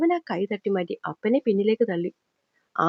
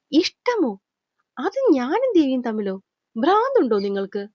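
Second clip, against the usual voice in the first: hardened angry disbelief; 3 semitones higher than usual. A narrator's reading. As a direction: push back hard in disbelief. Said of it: "അവന്റെ ശബ്ദം നല്ലോണം കടുത്തു".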